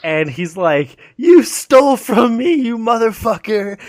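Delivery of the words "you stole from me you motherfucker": high, loud, drawn-out accusation; mock angry threat. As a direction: play an outraged army leader, hammed-up. Acting out army leader's threat.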